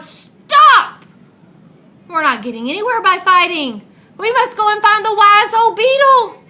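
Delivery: the frog leader yells to halt the fight; chief frog shouting. Acted. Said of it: "the chief frog shouted out".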